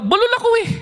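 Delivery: high, whining little excuse voice; mock whiny excuse. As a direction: mimic a childish whine to mock the excuse. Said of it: "Tumatanggi tayo dahil".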